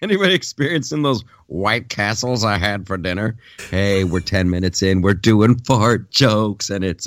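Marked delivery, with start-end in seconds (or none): 0.0-3.4 giggly, teasing question; a laughing dare to the room; laughing tease. Real, not acted. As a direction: tease them while laughing. Fart joke, laughing.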